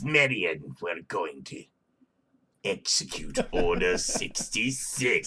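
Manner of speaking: low, soft menacing villain croon; a creepy low evil-emperor threat; sinister villain voice. Pretend. Villain voice continues, Star Wars.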